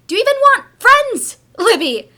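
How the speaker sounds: loud, high outburst; loud, high exasperated outburst; exasperated shout. Acted. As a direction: burst out in frustration. Mother snapping at daughter.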